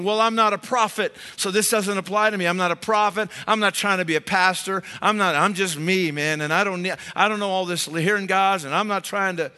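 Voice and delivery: a touch of hammed-up excuse-making; playing a skeptic brushing it off; defensive listener. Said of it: "And you might be thinking".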